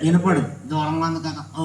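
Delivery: low, soft dummy voice; puppet character. Acted. Puppet saying the dog is too far, puppet voice. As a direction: explain it low and quiet as the puppet. Puppet explains the silent bark.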